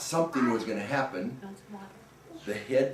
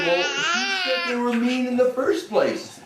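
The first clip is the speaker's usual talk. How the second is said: very high, wailing puzzled question; puzzled townsfolk; 13 semitones higher, 3 dB louder than usual. Acted. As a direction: cry out in baffled dismay. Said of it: "everybody in the town said".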